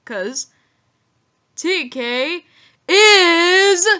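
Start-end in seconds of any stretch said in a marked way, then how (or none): 2.9-3.9 slow booming announcer call; ring announcer hype. Shown as a demonstration. One word to describe him.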